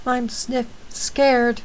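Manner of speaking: small voice behind a door, sniffling; scared little girl. Acted. Girl locked in a dark room, lightly voiced and scared. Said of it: "That's [name]'s voice".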